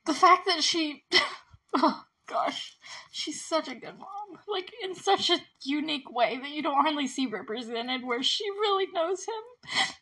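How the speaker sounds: high, tearful voice with a laugh breaking in; real choked-up admiration; trying not to cry. Real, not acted. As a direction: gush while fighting back tears. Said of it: "I'm trying not to cry".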